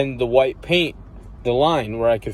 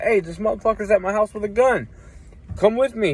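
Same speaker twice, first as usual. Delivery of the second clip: pitched-up panicked request; urgent high plea; 9 semitones higher than usual. Retold or imitated. Asking his buddy's uncle to come help, urgent high plea. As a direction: rush the plea, voice way up.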